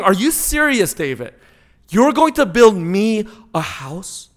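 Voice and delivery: mock disbelief, as if to a child asking something absurd. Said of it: "He's in disbelief".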